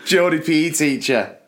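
slow, lightly put-on Geordie announcement; mock Geordie read. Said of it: "tremendous Geordie".

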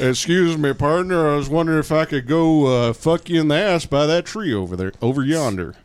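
John Wayne cruising. Retold or imitated. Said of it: "John Wayne cruising?".

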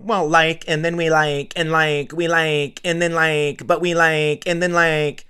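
hammed-up clueless youth who can't finish a thought; sneering impression of aimless youth; mocking young people. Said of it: "I'll say the youth of America".

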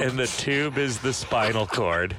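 low, slow spooky story voice; spooky storytelling. Acted. Tells a spooky story over music.

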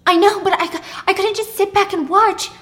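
high, loud protest; defensive. Acted. Pippa justifying herself to mad Thomas, high and defensive. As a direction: plead your case in a quarrel. Acted quarrel, Pippa answers back.

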